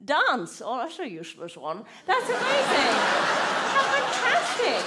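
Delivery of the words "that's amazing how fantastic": high, loud, fake enthusiasm; sarcastic gushing. Comedian mocks a dance student, fake high delight. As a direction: gush sarcastically over dance.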